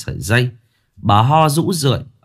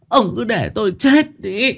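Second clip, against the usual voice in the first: wailing lament; 11 semitones higher than usual. A narrator's reading. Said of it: "khóc bù lu bù loa".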